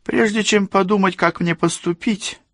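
very high put-on female voice; imperious young woman. A narrator's reading. Rosalinda weighs what to do, high and imperious. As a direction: set terms in a high-pitched voice. Rosalinda sets terms to Cassie.

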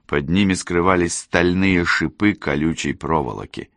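ordinary delivery, nothing marked

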